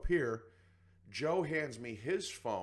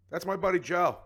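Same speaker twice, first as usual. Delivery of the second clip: proud announcement; 8 semitones higher, 6 dB louder than usual. Retold or imitated. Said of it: "And I went".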